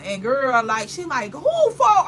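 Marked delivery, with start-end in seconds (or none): 1.3-2.0 higher, louder sudden surprise; startled question. Retold or imitated. Friend's mother startled to hear about the phone, surprised. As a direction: blurt it out, caught off guard.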